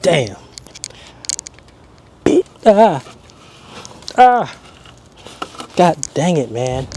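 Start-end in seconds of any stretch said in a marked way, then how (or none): 2.6-4.6 pained yelps. Real, not acted. Bolt slips, hurts hand.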